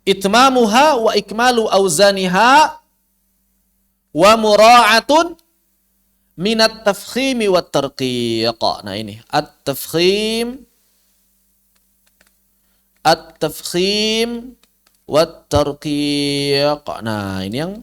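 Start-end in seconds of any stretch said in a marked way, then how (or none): 0.1-8.6 verse recitation. Shown as a demonstration. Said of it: "teacher recites the verse line". 9.3-16.8 at-tafkhim drawn out slowly, heavy and full; slow term demo. Shown as a demonstration. Teacher slowly sounds out at-tafkhim for students. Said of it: "ada harokat yang tafkhim, ada harokat yang tarqiq".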